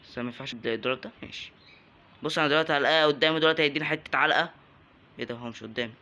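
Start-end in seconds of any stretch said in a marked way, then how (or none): none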